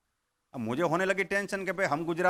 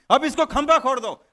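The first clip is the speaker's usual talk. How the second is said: loud order; 5 semitones higher, 7 dB louder than usual. Acted. Farmer orders ghost to dig pole.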